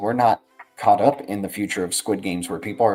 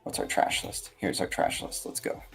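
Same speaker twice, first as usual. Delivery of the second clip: whispering; 8 dB quieter than usual. Acted. Catchphrase intro to the trash list.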